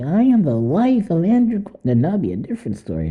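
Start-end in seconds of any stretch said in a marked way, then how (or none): 0.0-1.8 high mock grand announcement; self-important wife. Host playing a wife pulling rank, self-important. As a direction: declare the title in a raised, put-on voice. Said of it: "you'd be like".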